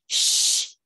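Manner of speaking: drawn breath of a shush; sharp "shh"; hushing shh. A narrator's reading. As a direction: shush him firmly. Mother hushes the officer.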